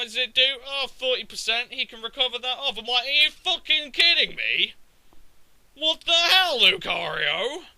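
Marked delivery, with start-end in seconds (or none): 3.0-7.6 loud, high-pitched retold disbelief; indignant mock outburst; incredulous exasperation. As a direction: protest as if cheated by the game. Retold disbelief at Lucario's damage.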